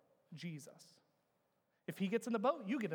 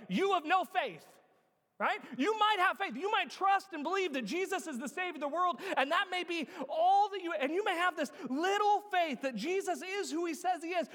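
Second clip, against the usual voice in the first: high, loud pulpit delivery; impassioned, raised preacher's voice; forceful preaching; 9 semitones higher, 5 dB louder than usual. Real, not acted. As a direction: drive the correction home to the congregation.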